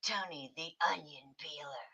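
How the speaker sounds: hushed, ferocious wrestler name reveal; mock ferocious voice. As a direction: play at menace, very softly. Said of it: "that's my ferocious voice".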